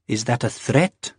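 slow, disbelieving menace; incredulous and menacing. Acted. Wife to Jamie, incredulous and menacing. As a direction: warn him while doubting your ears. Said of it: "in a tone as incredulous as it was menacing".

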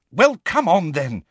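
high, urgent retort, cut off midway; exasperated. A narrator's reading. Hermione has been urging Harry to leave, exasperated. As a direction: retort impatiently, pitched high.

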